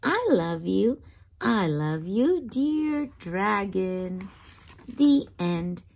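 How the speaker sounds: ordinary delivery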